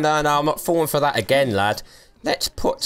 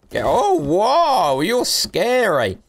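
slow, high, drawn-out mock scared; hammed-up scared whoa; mock fright; 8 semitones higher, much slower than usual. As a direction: pretend to be spooked, stretching it out.

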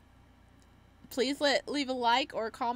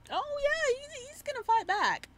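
much higher, sing-song sarcastic cheer; mock enthusiasm. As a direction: oversell it like you don't quite believe it. Mock enthusiasm about game line.

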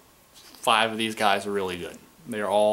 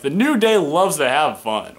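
high, slow, loud corny commentator; drawn-out cheesy announcer line; mock-cheery play-by-play; mocking Michael Cole; 5 semitones higher, 7 dB louder than usual. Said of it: "he'd be like".

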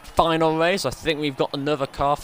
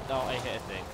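ordinary delivery

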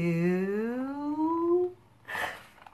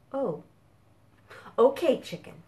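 usual speaking voice

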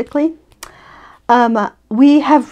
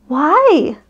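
put-on little child voice; 5 semitones higher than usual. A narrator's reading. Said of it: "little Cub asked".

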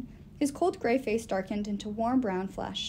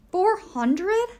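surprised; 10 semitones higher, 7 dB louder than usual. A narrator's reading. Magnus surprised at number.

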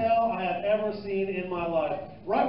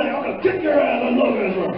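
louder mock threat shout; Wayne shouting; 3 semitones higher, 9 dB louder than usual. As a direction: bellow it like an angry local. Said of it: "Wayne said".

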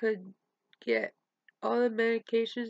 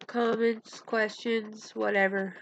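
normal talking voice

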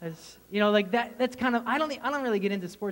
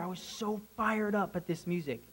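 ordinary talk, nothing marked

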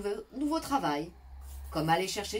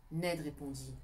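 usual speaking voice

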